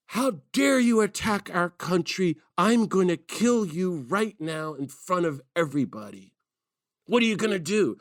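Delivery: high, shouted fury at a protester; high-pitched enraged yell; retold furious yell. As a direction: yell it like a Marine losing it. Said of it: "screaming his head off".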